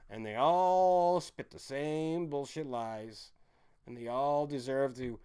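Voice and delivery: slow, stretched, every word weighted; slow contemptuous hammering; slow emphatic. Real, not acted. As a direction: drag it out to hammer it home. Slow emphatic rant line.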